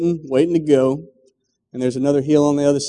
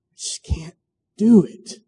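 despairing cry; 5 semitones higher than usual. Acted. Climber gives up, breaking.

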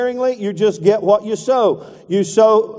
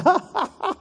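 laugh; 9 semitones higher, 5 dB quieter than usual. Real, not acted. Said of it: "belly laugh".

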